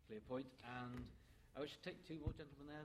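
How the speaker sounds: normal talking voice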